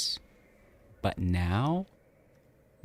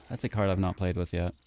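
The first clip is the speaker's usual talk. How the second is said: normal talking voice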